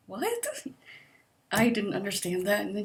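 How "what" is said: squeaky, very high "what"; incredulous high squeak; baffled. Real, not acted. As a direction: pitch jumps way up in confusion. Baffled by therapist's claim.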